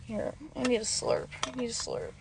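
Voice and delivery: ordinary talk, nothing marked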